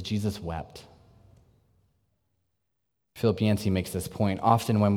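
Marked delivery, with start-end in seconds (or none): 0.0-0.8 hushed solemn verse. Acted. Quoting the shortest verse.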